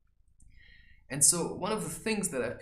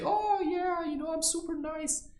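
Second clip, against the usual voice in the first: much higher, sing-song fake niceness; lightly voiced celebrity gushing; mock sugary celebrity. Celebrity showing off to the media, mock sweet.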